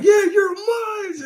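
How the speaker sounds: high strained shouted vampire line; screechy mock vampire shout. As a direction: yell it like a movie vampire gloating. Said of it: "And then he was like".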